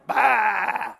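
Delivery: put-on shouted baby baa; toddler baa. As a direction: call out a little baa. Twins' animal sounds.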